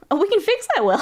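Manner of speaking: high loud teasing; playful excitement. Real, not acted. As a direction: tease them with mischief. Playful threat to viewer.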